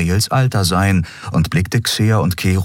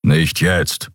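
impatient grumble. A narrator's reading. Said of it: "brummte er ungeduldig".

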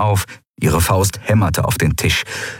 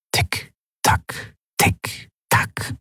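ticking clock; 5 dB quieter, much slower than usual. A narrator's reading. Clock ticking in tense silence.

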